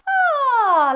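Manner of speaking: high sliding "ah"; relaxed head-voice sigh. Shown as a demonstration. Teacher showing relaxed head voice, sighing. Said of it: "as if you were sighing".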